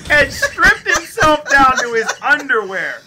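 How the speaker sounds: high-pitched laughing disbelief. Real, not acted. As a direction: laugh through the words, squealing.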